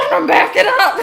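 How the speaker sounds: fast, high, loud laughing shout; laughing panic. Pretend. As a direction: blurt it out through laughter. Joking about the roller coaster.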